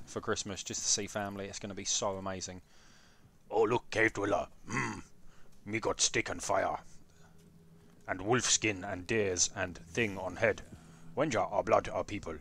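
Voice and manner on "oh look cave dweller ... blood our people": low caveman grunt-talk; halting low caveman speech. Pretend. Acts as a caveman.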